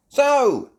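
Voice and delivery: very high, loud opener; Miss Rabbit. Acted. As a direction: call the group to attention. Miss Rabbit begins tour.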